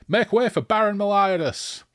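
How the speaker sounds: loud, high-pitched herald's shout; bellowed announcement. Acted. Rider announcing the Baron to clear the way, bellowing. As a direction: bellow it out ahead of the horses. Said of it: "bellowed Deverk".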